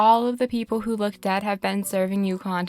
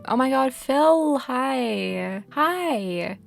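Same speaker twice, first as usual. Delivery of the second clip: delighted surprise; 5 semitones higher, much slower than usual. Real, not acted. Spotting her old villager friend Phil in the game, slow delighted cry.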